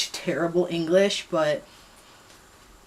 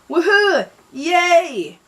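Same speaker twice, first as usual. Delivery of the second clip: very high, loud cheer of victory; triumphant yell; 11 semitones higher, 7 dB louder, much slower than usual. Acted. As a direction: whoop it out after the rescue. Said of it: "Wesley yelled".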